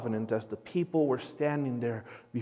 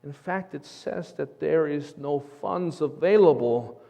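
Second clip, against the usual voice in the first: usual speaking voice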